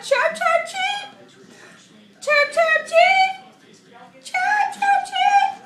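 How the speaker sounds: very high, loud chirping calls; baby bird chirps. Pretend. Voicing the baby birds calling to mama, high chirps. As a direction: peep like hungry chicks.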